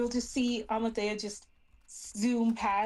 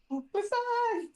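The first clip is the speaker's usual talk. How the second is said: calling out; 11 semitones higher, much slower than usual. Acted. Tiny fairy searching the inn, calling for her son. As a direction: shout for him while flying around lost.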